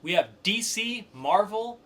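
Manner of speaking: punchy host voice kicking off the poll segment; show announcer. Acted.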